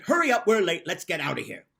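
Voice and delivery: hustle your sister out the door; bossy hurry. Acted. Bossy big sister rushing her little sister home, loud. Bossy Annie drags Rosie.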